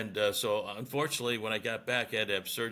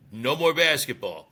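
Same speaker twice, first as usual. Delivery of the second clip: stern order; 4 semitones higher, 9 dB louder than usual. Retold or imitated.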